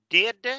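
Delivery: Did said high and loud; d sound, loud. Shown as a demonstration. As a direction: hit the d in Did.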